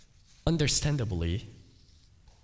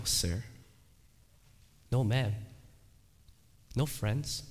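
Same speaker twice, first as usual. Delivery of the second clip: normal talking voice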